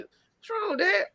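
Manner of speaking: put-on high, puzzled little boy asking his dad; nine-year-old son. Father voicing his son asking what's wrong, high kid voice. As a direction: pitch way up like a worried child. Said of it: "this is a nine-year-old talking".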